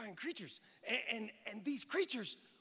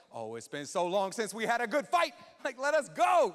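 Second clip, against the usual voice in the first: itching for a fight, swelling to a shout; rallying warrior hype; battle-eager angel; 7 dB louder than usual. Acted.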